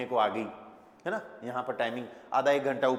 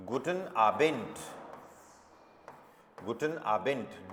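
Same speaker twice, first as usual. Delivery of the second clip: "guten Abend" drawn out very slowly, the final D said as T; very slow demo; 3 semitones lower, 3 dB quieter, much slower than usual. Shown as a demonstration. Teacher to students, very slow German demo. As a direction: stretch every syllable out for learners. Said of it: "डी किसी भी word के एंड में आता है".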